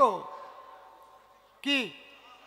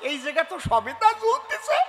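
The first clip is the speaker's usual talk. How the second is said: monkey's delight; 6 semitones higher, 4 dB louder than usual. Acted. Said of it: "বান্দর ... ভাবছে".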